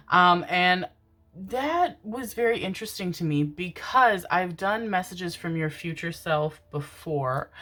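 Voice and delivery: ordinary talk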